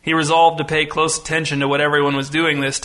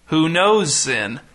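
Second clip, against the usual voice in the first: slow question with "knows" raised and stretched; stress on KNOWS; much slower than usual. A narrator's reading. Pastor repeating his question from the pulpit, stressing "knows". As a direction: lean hard on "knows". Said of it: "rotating emphasis on each word".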